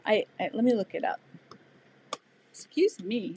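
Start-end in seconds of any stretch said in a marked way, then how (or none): 1.0-3.4 talking through hiccups. Real, not acted. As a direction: speak with the hiccups. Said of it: "I got the hiccups today".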